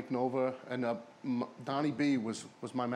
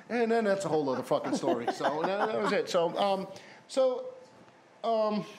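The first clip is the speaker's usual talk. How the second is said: laughing through the words, pitched up; can't stop chuckling; real laughing wrap-up; 7 semitones higher, 5 dB louder than usual. Real, not acted.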